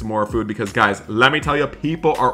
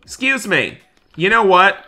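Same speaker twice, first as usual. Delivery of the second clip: higher, louder stern orders; stern shouting; 3 semitones higher, 4 dB louder than usual. Acted. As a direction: throw the party guests out. Ejecting partiers second time.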